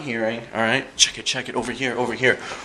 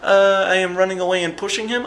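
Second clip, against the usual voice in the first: dopey fighter voice; 8 semitones higher than usual. Retold or imitated.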